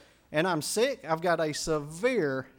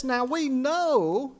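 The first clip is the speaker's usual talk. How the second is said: "Now we know" drawn out very slowly, higher and louder; slow emphatic; 6 semitones higher, 5 dB louder, much slower than usual. Shown as a demonstration. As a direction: lean on every word, stretching it out. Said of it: "this is important".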